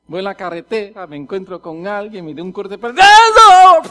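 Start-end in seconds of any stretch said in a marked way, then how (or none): 2.9-3.9 very loud, high shout; explosive acted yell; road-rage outburst. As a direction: yell at a driver who cut you off. Acts reacting to being cut off.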